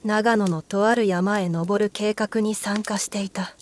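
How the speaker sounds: ordinary delivery, nothing marked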